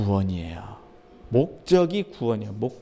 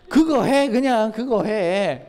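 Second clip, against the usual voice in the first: higher and louder, the second one slowed right down; firm command; 6 semitones higher, 3 dB louder, slower than usual. Acted. Jesus telling the grumbler to just do his job, loud and slow. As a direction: lay down the order, then drag it out slowly.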